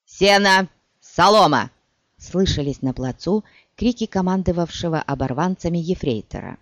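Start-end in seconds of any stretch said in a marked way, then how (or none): 0.1-1.7 loud barked drill calls; drill shout. Acted. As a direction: bark the cadence at recruits.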